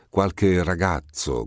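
usual speaking voice

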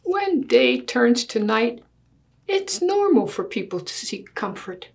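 put-on lively storybook read in a child's earnest voice; reading to children. Child argues with Daddy over the big bed, earnest storybook reading. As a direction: read it like a kid making a serious case. Reads picture book aloud.

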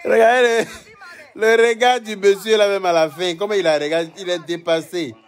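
delighted disbelief; excited. Real, not acted. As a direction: point it out with glee. Excited about the man's face.

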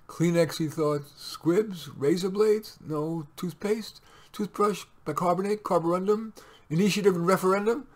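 puzzled run of rising queries; questioning list. Acted. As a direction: try out each item as a question. Mitty guessing at forgotten shopping items.

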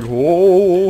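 loud, high mock whoa; imitated amazement. Gamer imitates stunned enemies, loud mock amazement. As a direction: mimic onlookers being stunned. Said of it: "they're like".

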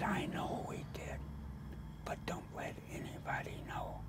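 very soft unvoiced confession; whisper. Curator whispering back to the artist, hushed confession. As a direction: confide it under your breath.

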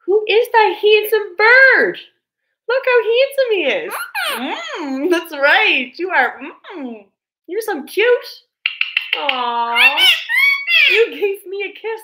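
high, loud pet talk. Real, not acted. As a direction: coo over the handsome bird. Cooing at the bird.

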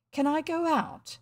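a touch of gloom; gloomily. A narrator's reading. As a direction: ask hoping for nothing. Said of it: "he said gloomily".

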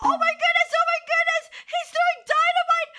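loud, high frantic alarm; panicked shouting. Pretend. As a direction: shriek a warning as dynamite falls. Dynamite thrown at the player.

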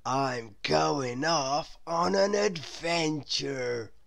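slow, dreamy character voice; slow whimsical invitation; Mister Daydream voice. A narrator's reading. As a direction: announce it slowly, full of wonder. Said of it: "said Mister Daydream to Jack".

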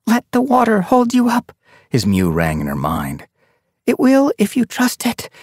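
lightly voiced, high teaching; father's lesson. A narrator's reading. Father remembered teaching his kit, lightly voiced. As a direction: teach calmly.

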